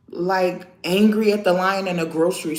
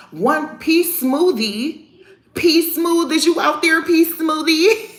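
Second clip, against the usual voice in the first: higher, louder call stretched out; 7 semitones higher, 3 dB louder, slower than usual. Real, not acted. Calling out to viewer P Smoothie, loud drawn-out call. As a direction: call out his name like across a room.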